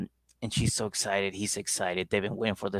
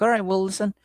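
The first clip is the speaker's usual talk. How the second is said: higher and louder, building to a shrill demand; higher, loud, exasperated girlfriend voice; mock angry girlfriend; 10 semitones higher, 5 dB louder than usual. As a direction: nag him, rising to a yell. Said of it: "she got mad at him".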